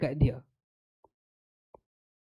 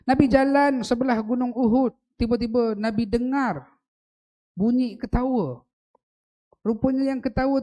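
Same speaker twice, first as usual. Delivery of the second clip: high, loud impassioned preaching; preaching at full force; 8 semitones higher, 6 dB louder than usual. Acted. Preacher tells the congregation of Mount Uhud laughing, high and loud. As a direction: lift the crowd with a loud, soaring telling.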